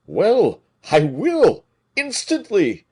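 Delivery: high, loud eager reply; eager compliance. A narrator's reading. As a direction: jump to obey at once. Porthos eagerly obeying.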